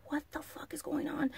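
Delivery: unvoiced high whisper of shock; whispered disbelief. Whispering at the teenagers' antics during the concert. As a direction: hiss it under your breath in disbelief.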